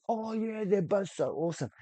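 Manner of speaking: high, mocking gush; high, mocking take on a reviewer's gushing. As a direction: parody his praise in a high, silly voice. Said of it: "The Critical Drinker said".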